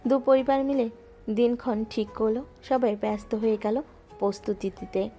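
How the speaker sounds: ordinary delivery, nothing marked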